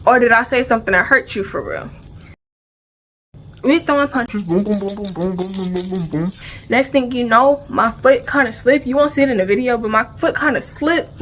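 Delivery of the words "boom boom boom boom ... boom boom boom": soft, lower punch noises; mock punch noises. Retelling the fight at school, she mimics the punches in soft, low booms. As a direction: tap out each punch softly.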